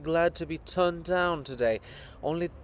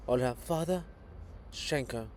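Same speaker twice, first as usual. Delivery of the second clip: ordinary delivery, nothing marked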